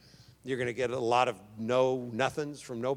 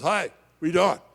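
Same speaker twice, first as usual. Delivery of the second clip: higher, much louder put-on voice of the caller; chirpy friend; 6 semitones higher, 13 dB louder than usual. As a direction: play the cheerful friend calling late. Friend's late-night phone call voiced.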